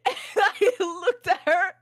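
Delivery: high, squeaky words through real laughter; cracking up while telling it; helpless laughter. Real, not acted. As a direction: barely get it out for laughing. Teller losing it at own story.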